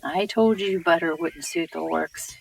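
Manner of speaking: usual speaking voice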